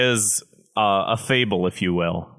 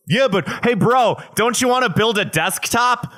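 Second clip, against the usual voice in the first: mock the desktop bros, getting higher and more frantic until shouting; building rant; 10 semitones higher than usual. Podcaster mocking laptop advice-givers, escalating to a high shout.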